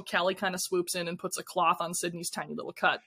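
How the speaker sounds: usual speaking voice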